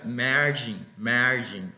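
"margin" with a lengthened first vowel; careful model of "margin", long A; long A demo. Shown as a demonstration. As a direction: stretch the ah in "margin". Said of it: "Pero más larga".

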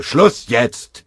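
loud, high cut-off command; sergeant's emphatic order. A narrator's reading. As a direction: shut the argument down with a shout. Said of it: "befahl der Feldwebel".

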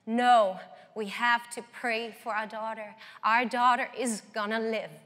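firm, loud declaration of faith; a father refusing to give up, loud and strong. Retold or imitated. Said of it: "in a very loud and strong voice".

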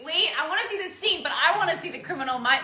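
high, loud, eager insistence; excited eagerness. Real, not acted. Eager to see the episode.

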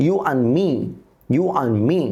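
and said as an, joined to me; and without d. Shown as a demonstration. Teacher demonstrating elision for learners, d left out. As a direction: let the d in and vanish, you-an-me. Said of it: "আমরা টি এবং ডির উচ্চারণ করব না".

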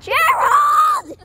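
high, loud call; shouting a name. Real, not acted. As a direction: yell his name out. Calling out to Gerald.

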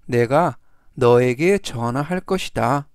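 ordinary talk, nothing marked

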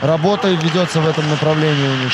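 ordinary talk

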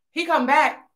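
usual speaking voice

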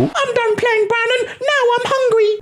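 put-on high whiny baby voice. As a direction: whine like a hungry toddler. Baby wants food.

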